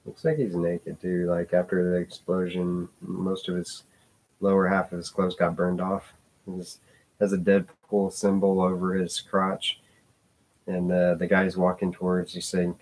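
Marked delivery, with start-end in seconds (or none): none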